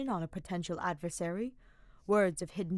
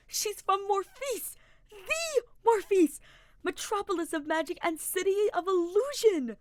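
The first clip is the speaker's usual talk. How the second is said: squeaking fan; 13 semitones higher, 7 dB louder than usual. A narrator's reading. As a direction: gush like a starstruck fan. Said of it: "Sylvain squeaked, like an adoring fan".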